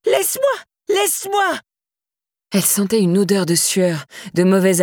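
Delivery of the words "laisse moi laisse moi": very high, desperate screams; spitting screams. A narrator's reading. Laura in her nightmare fighting Daniel, screaming. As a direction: scream it as you fight him off. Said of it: "en criant".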